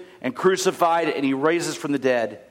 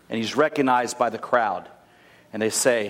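usual speaking voice

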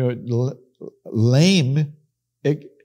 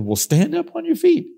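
much higher, ringing command as Paul; high commanding call. Retold or imitated. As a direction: call out high to the lame man. Paul commanding the lame man.

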